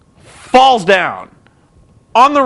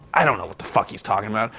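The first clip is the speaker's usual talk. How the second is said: ordinary talk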